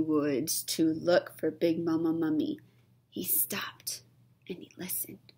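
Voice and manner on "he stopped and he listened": hushed suspense whisper. Acted. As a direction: drop to a whisper to build suspense.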